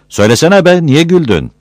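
high, loud impatient questioning; insistent demand. Acted. Villager demanding why the man laughed, insistent.

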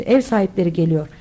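ordinary delivery, nothing marked

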